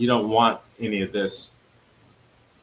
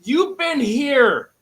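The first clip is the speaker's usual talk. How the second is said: angry; 14 semitones higher, 6 dB louder, much slower than usual. Real, not acted. Angry outburst.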